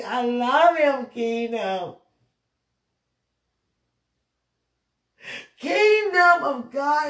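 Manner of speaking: high, loud, drawn-out declaration; slow, soaring cry of devotion; impassioned proclamation. Real, not acted. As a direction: cry out your love to the whole world. Proclaiming love aloud to world, kingdom.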